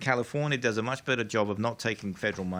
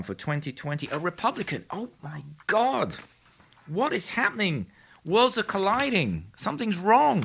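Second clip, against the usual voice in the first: hammed-up disbelief, pitched higher; sarcastic oh-my-God astonishment; mock shock; 5 semitones higher than usual. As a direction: play up fake alarm at the results. Sarcastic shock at own data.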